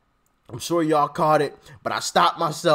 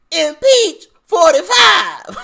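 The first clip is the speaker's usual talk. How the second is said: higher, louder, slow drawn-out yell; mock rally shout; 12 semitones higher, 8 dB louder, slower than usual. Vlogger mocks Maxine Waters, bellowing it slowly. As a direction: stretch the shout like a crowd chant. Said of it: "I hate this lady's voice".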